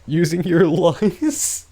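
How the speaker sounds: high, loud, very slow and drawn out; mock dramatic outrage. Mocking the idea of breathing in a hammed-up wail. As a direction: wail it like a melodrama villain.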